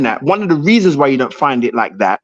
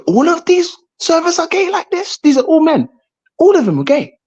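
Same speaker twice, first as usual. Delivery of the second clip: retold astonishment; 12 semitones higher than usual. Imitating his own surprised self.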